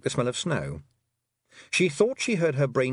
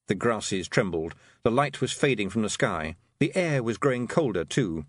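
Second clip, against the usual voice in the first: ordinary delivery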